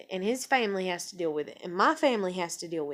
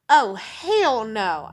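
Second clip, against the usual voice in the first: defiant hell no; 8 dB louder, much slower than usual. Retold or imitated. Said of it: "'Cause you're like".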